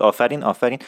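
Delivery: normal talking voice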